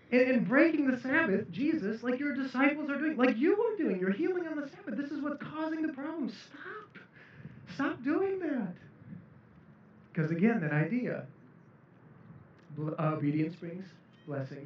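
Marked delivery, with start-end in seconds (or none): 0.1-8.7 higher, pleading reproach to Jesus; scolding Pharisee. Acted. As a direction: tell Jesus to stop, scolding.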